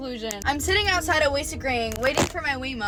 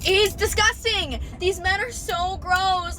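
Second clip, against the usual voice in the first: disgusted rant; 6 semitones higher, slower than usual. Real, not acted.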